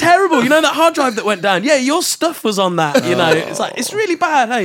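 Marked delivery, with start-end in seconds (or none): 0.0-3.0 high, loud fake panic; mock distraught. Producer pranking artist about a lost record, mock distraught. Repeats fake bad news, retold. 3.8-4.7 high-pitched hammed-up regret; mock dismay. Producer keeping up the prank on the artist, mock dismay. Fake bad news continues.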